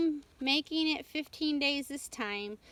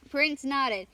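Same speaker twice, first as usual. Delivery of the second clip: usual speaking voice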